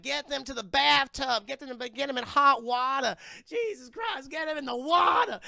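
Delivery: high, loud urgent commands; Zelda the medium. Retold or imitated. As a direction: bark frantic orders as the medium. Zelda orders urgent bath.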